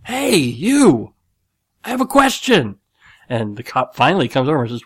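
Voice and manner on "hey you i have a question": high, loud hail to a distant cop; insistent grandpa shouting for attention; calling out. Retold or imitated. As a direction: yell over a crowd for attention. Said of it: "goes and yells to him".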